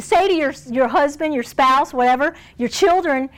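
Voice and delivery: normal talking voice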